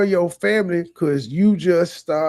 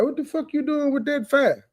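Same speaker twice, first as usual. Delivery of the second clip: high-pitched mock yell at his son; imitating Clem's outrage; 8 semitones higher than usual. Host voicing the dad spotting the fire, high and mocking. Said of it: "Clem was like".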